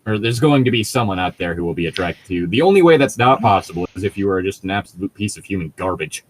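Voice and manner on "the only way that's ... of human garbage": ordinary delivery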